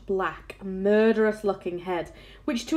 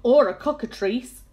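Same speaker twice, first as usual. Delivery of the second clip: louder, higher exclamation; mild yell; 4 semitones higher, 6 dB louder than usual. A narrator's reading. Police chief adding his own monster guess, mild yell. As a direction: raise the voice in a touch of alarm. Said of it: "yelled the chief of police".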